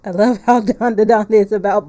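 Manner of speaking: laughs through the line, loud and hearty; cracking up mid-sentence; loud laughing. Real, not acted. Streamer laughing loudly at the game's joke.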